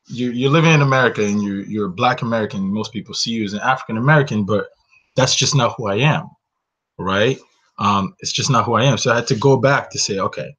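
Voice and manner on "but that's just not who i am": ordinary delivery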